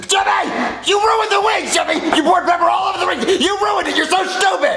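put-on high, angry shouting at the boy; yelling. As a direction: scold him at full voice. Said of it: "I'm tired of you yelling at me".

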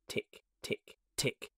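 soft, spaced, unvoiced ticks; imitated clock ticking. A narrator's reading. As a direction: tick softly like an approaching clock. Approaching clock sound imitated.

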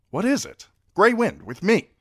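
Grey Wind, with me! as a sharp command; king calling his balking direwolf, loud and high. Acted. Robb calls his wolf.

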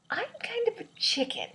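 high, squeaky self-mocking admission; chicken squeak. Real, not acted. Admitting to the camera she is a chicken, high squeak. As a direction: squeak it out like a scared little chicken. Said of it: "But I'm just like".

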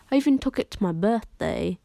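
ordinary talk, nothing marked

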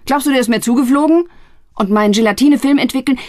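high, loud angry retort. Acted. Brother shouting back at his sister. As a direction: fire it back.

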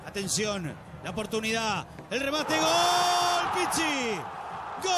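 building to a roaring goal call; goal shout. Real, not acted. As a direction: scream the goal.

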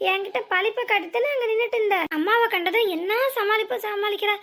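ordinary delivery

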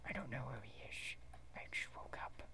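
very soft, half-whispered; whispered answer. Acted. Character who just woke up, whispered. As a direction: say it under your breath.

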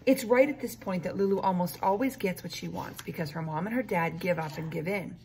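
usual speaking voice

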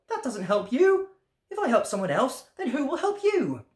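high, questioning frog voice; curious frog, pitched well up; puzzled frog. A narrator's reading. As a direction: ask in a high-pitched storybook character voice.

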